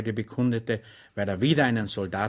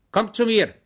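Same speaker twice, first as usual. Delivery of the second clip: higher, louder call to the dog; 10 semitones higher, 9 dB louder than usual. A narrator's reading. Schweig calling the dog over to him, loud call. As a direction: call the dog across the room. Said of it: "rief Schweig ihm zu".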